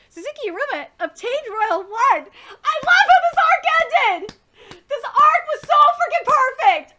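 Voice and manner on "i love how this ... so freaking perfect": loud, high, laughing praise; excited gushing. Real, not acted. Vlogger excitedly tells viewers how perfect the arc was. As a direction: shout your love for the arc.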